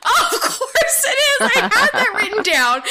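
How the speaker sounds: high-pitched, laughing protest; laughing exasperation. Real, not acted. Guest protests losing to the host, laughing exasperation. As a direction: wail at the missed answer while laughing.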